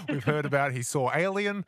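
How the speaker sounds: ordinary talk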